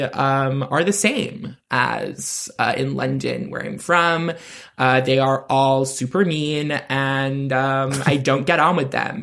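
put-on convincing American accent, as an interviewee. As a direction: answer in an assumed American accent. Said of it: "you go American".

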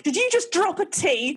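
a hint of a shocked grandma asking; mock grandma. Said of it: "my grandma is like".

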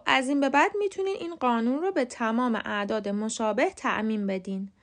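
ordinary delivery

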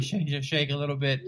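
stuttering, trembly mock nerves; imitated shaking voice. Comic losing his nerve on stage, imitated shaky voice. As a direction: act out a voice starting to shake. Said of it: "My voice starts to shake".

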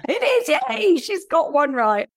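high-pitched excited yay; delighted cheer. Real, not acted. Quiz host cheering her friend's first right answer, delighted. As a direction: cheer a small win with glee.

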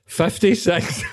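high, laughing punchline; laughing reply. Pretend. Mate answers the joke question, laughing high. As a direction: blurt it through laughter. Joke age in casting bit.

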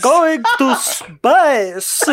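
put-on slow, loud, high Russian accent; Tim Curry Russian accent. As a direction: stretch it in a hammy Russian accent. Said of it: "Tim Curry doing a Russian accent".

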